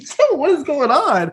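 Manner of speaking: high, slow stunned reaction; slow high disbelief. Retold or imitated. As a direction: drag it out in disbelief.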